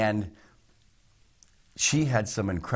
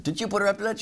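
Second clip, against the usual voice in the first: high, called-out question to the next room; husband calls out to wife in another room, playful yell; mock yell; 13 semitones higher, faster than usual. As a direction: call out to the wife, amused. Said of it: "I even yelled at Lynn".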